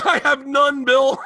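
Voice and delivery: high, loud comic protest; exasperated admission. Real, not acted. Bert admitting to Bill he lacks swagger, loud wail. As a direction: cry it out in helpless self-mockery. Owns up loudly when criticised.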